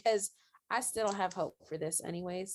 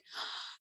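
sharp, soft intake of breath; retold gasp; 13 dB quieter than usual. Viewer at the point of stopped breathing, shocked gasp. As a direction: suck in a breath and freeze.

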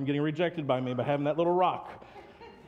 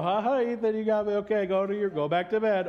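higher, louder play-along; mock scared dad; 7 semitones higher, 5 dB louder than usual. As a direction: pretend to be startled. Dad playing along with son.

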